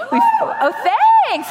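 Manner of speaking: sudden high laugh of surprise; surprised laugh. Real, not acted. Speaker interrupted mid-talk by the audience, real surprised laugh. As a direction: caught off guard, then a quick thanks.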